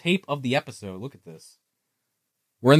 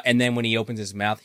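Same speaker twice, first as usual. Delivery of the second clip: ordinary delivery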